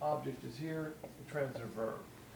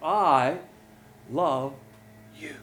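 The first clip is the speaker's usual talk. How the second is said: "I" loud and stretched, "love you" tailing off; stress on I; 5 dB louder, much slower than usual. Shown as a demonstration. Preacher voicing God's love for the world, stress on I. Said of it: "God's the subject".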